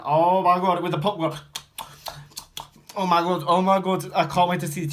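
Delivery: high, loud gushing; mock excitement. Cinema-goer raving with the popcorn, mock excitement. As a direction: gush at full volume, hammed-up.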